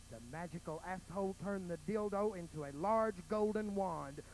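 normal talking voice

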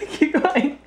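laughing encouragement. Real, not acted. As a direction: keep going through a laugh. Cheering child, laughing.

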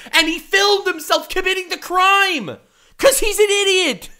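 loud high exasperated rant; exasperated yelling. Real, not acted. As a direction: yell it in disbelief. Ranting about YouTuber crimes.

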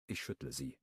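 usual speaking voice

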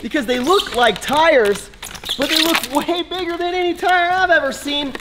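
high, amazed exclaiming; wide-eyed wonder at something huge; excited disbelief. Real, not acted. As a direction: gawk at the giant tires. Excited reaction to huge tires.

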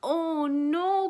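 loud, high alarmed cry. Acted. Bees crying out as the mouse ducks under, loud and high. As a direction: cry out in dismay. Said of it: "cried the bees".

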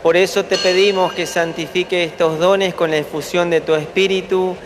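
usual speaking voice

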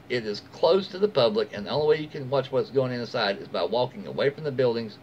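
ordinary delivery

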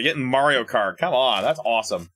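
ordinary talk

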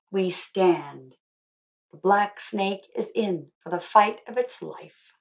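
slow, solemn picture-book reading; story reading. Acted. Author reading her book's ending aloud to the schoolchildren, slow and solemn. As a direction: read the closing lines slowly, like a rallying call.

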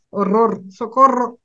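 loud, high mock cry of horror; mock panic. Learner recalling her panic at meeting the shop owner, loud mock alarm. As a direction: cry out socorro in playful alarm. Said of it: "I am like".

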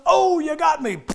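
high, loud hammed-up death cry; dying cowboy. Preacher mocking an old western death scene, hammed-up. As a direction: ham up a shot cowboy going down. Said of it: "he goes".

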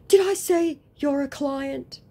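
high, loud scammer voice; mock indignant retort. Scammer disputing what he said, mock indignant. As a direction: throw it back in a high put-on voice.

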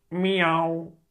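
high, loud, long meow; cat meow. A narrator's reading. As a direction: meow back instead of answering. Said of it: "zegt Dikke Teun".

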